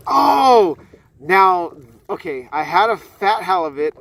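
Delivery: sudden loud surprised outburst. Real, not acted. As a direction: caught off guard, exclaiming. Surprise at fish in mouth.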